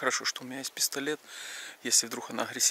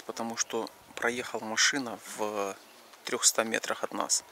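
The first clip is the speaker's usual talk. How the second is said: ordinary delivery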